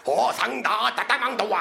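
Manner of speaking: a run of pretend Chinese until the air runs out; lightly voiced mock Chinese rattle; mock Chinese babble. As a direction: rattle off fake Chinese syllables without a breath. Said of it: "they talk till they run out of breath".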